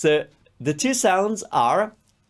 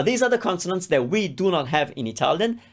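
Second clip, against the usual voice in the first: ordinary talk, nothing marked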